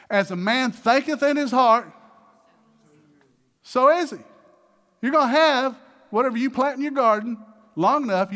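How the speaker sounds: loud, high sermon climax; preaching at full force. Acted. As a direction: proclaim it to the congregation.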